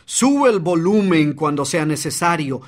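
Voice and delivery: ordinary talk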